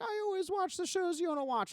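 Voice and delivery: high, put-on voice for his girlfriend Jordan; girlfriend's voice. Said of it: "She's like".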